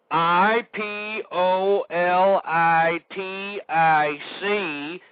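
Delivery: each letter dragged out with a gap; slowly spelling out the web address letter by letter to listeners he calls imbeciles; spelled out slowly. Shown as a demonstration. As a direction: spell it out as if to a slow child, mocking. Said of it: "I'm gonna say it real slow".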